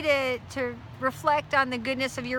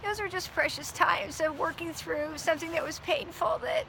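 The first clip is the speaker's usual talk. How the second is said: softer, higher, choked with emotion; emotional; 4 semitones higher, 4 dB quieter than usual. Real, not acted. Wife recalling precious times from a painful season, emotional. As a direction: let real emotion into the voice, softly. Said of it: "brings that emotion to your voice".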